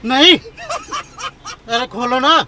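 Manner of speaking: loud, high, frightened cries to be let out; panicked pleading. Real, not acted. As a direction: pleads in real panic to open up. Trapped by pranksters, begging out. (डर से ऊँची आवाज़ में गिड़गिड़ाना)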